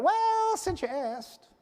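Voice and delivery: a touch of cautious candor; hesitant soldier. Retold or imitated. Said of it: "The second or third time they went".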